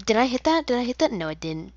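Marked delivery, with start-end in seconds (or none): 0.1-0.7 eager high-pitched check; hopeful loud did I hit; excited high question. Real, not acted. 0.7-1.1 hopeful did I hit; excited high question. Real, not acted. Excited check of shot.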